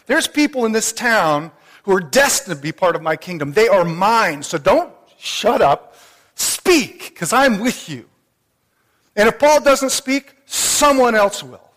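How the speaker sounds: loud, ringing preacher's voice, slowing on key words; preaching at full force. Acted. The Lord urging Paul, preached at full force. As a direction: thunder the Lord's command to speak out.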